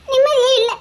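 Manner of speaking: wife weeping to husband; crying. Acted. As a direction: let the sob break through. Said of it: "அழாத, அழாத".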